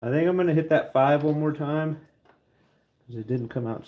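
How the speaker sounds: normal talking voice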